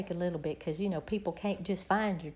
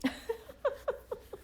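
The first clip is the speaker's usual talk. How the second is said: breathless giggle; 13 semitones higher, 6 dB quieter than usual. Real, not acted. Laughing at contagious yawning.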